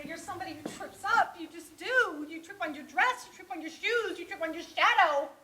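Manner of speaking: exasperated sermon to oneself; self-scolding tirade. Acted. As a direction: hammer each fault harder, up to a verdict. Berates herself as a disaster.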